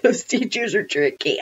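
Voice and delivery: ordinary delivery, nothing marked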